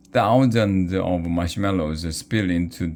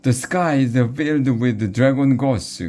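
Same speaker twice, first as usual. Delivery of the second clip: high, loud cry of wonder; excited cry; 7 semitones higher, 3 dB louder than usual. A narrator's reading. Wiglaf sees the dragon ghosts, high excited cry. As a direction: gasp out what you see above. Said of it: "cried Wiglaf".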